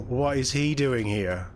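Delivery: usual speaking voice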